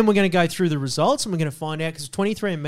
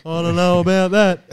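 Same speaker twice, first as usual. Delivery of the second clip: mock backseat heckler. Host mocks himself heckling the other two, laughing. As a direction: play the smug critic throwing pot shots between laughs. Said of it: "I'll sit back and just go".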